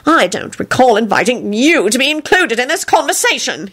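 snooty and dismissive; rude. A narrator's reading. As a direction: freeze out the intruder pompously. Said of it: "he told him rudely".

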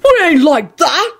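high, indignant protest; wrathful yell. A narrator's reading. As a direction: deny it in outrage. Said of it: "Hagrid yelled wrathfully".

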